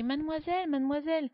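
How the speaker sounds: high, loud repeated call; shouting. A narrator's reading. Boy calling the girl in the library, loud shout. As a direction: shout her name into the dark hall. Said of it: "je crie".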